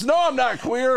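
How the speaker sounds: high, indignant retort; mishearing voice. Acted. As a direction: squeak it out offended. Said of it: "And then the music stops".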